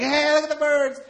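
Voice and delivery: put-on high childlike call; excited kid. Retelling school trip, calling out high like a kid. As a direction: point out the birds like a curious kid. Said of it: "We're like".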